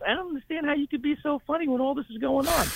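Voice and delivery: imitating the nurse. Nurse puzzled by joking patient, high mock voice. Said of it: "She goes".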